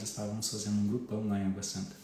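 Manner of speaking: ordinary talk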